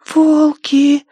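put-on high, loud child voice; little girl voice. A narrator's reading. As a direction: speak like a demanding little girl. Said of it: "прошептала Агнес".

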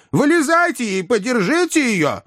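very high pleading yell. A narrator's reading. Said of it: "завопил мистер Уинкль". (высокий вопль о помощи)

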